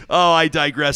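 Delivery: ordinary talk, nothing marked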